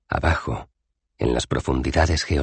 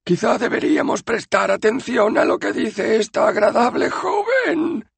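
nervous backpedal; 4 dB louder than usual. Acted. Citizen with a sword at his neck, squeaking nervously. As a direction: swallow hard and give in.

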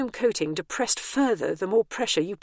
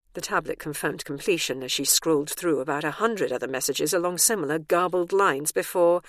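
ordinary delivery

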